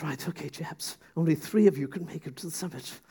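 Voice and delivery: mock TV host with an altered voice; put-on host voice, starting softly; Ben Shepherd. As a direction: impersonate a presenter briefing the chaps. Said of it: "That's how he talks, obviously".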